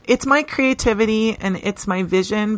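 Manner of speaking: normal talking voice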